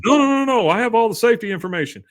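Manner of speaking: high, loud, rushed mock panic; flustered protest. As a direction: imitate a salesman scrambling to object. Acted salesman protesting in haste.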